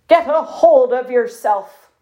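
firm military puffin major's command; ordering. A narrator's reading. Major Puff rallying himself before battle, ordering. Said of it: "Major Puff ordered".

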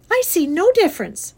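high, loud, stubborn retort; indignant girl. A narrator's reading. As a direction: dig in like a defiant child. Fern keeps arguing with father.

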